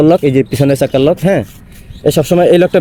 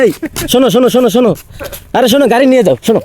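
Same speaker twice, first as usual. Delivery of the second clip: yelling across the room; 6 semitones higher than usual. Real, not acted. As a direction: call them back from afar. Calling the kids back.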